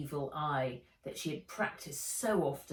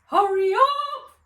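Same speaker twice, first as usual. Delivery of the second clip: high, loud, slow warble; warbling; 12 dB louder than usual. A narrator's reading. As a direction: warble the hurry-up. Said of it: "warbled Fred".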